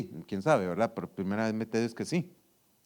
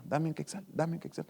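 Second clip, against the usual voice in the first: softer, repeated little plea; a small nagging voice asking for a coin; mild mock begging, softer; 3 semitones higher, 8 dB quieter than usual. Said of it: "esa vocecita va".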